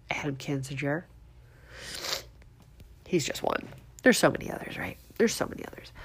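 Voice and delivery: hushed, mostly voiceless whisper; whispered aside. Pretend. Host slips in a politician's name to listeners, whispered aside. Aside naming one culprit among many.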